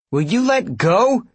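high, loud, drawn-out protest; indignant demand. A narrator's reading. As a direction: demand release, exasperated. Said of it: "she demanded".

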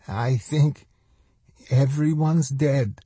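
high, slow woman's voice; woman survivor. A narrator's reading. Woman telling the stranger of the dead, high and slow. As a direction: pitch it up and say it slowly.

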